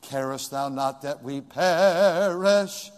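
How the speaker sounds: slow, even quoting of "Carest thou not that we perish?" without shouting; preacher naming the hymn, slow and calm; song title quoted. Shown as a demonstration. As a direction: read the title calmly and slowly. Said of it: "We sang that song tonight".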